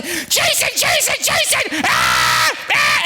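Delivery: high frantic mock screams of the name; panicked screaming. Wife with sliced finger shrieking for her husband in panic. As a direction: imitate a wife in pain crying out.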